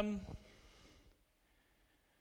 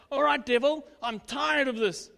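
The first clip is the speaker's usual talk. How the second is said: confronting the devil; 3 semitones higher, 4 dB louder than usual. Retold or imitated. Declaring war on the devil, a loud defiant call. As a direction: stand up to the devil, loud and firm.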